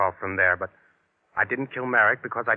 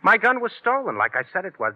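sudden high loud outburst; protest; 9 semitones higher, 3 dB louder than usual. Acted. Suspect protesting to the cop, loud and high. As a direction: shout it in protest.

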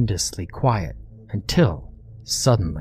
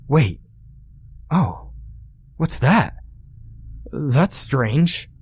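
puzzled surprise; 5 semitones higher, slower than usual. A narrator's reading. Ryan noticing the grenade.

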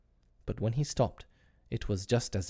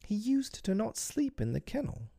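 put-on high, bewildered boy's voice; unsure of memory; 14 semitones higher than usual. A narrator's reading. John doubting his memory of father, bewildered. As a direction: puzzle it out in a high voice. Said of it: "like one who had lost faith in his memory".

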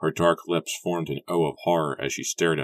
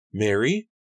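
raised, high call; 5 semitones higher, 4 dB louder than usual. A narrator's reading. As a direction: call her name from afar. Rebecca calls out from garden.

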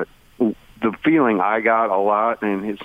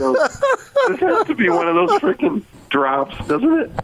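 amused; 14 semitones higher than usual. Real, not acted. Laughing aside, high excited.

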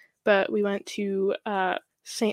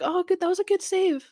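friendly opponent; 9 semitones higher than usual. Retold or imitated. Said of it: "the other team will come up and be like".

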